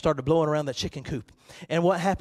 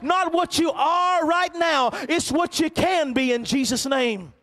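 full-force preaching; 10 semitones higher, 5 dB louder than usual. Acted. Pastor challenging the church to soar, in full-force preaching. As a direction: drive the message home with fervor.

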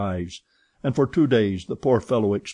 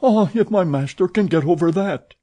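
muttered hope; 9 semitones higher, 5 dB louder than usual. A narrator's reading. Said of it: "muttered he".

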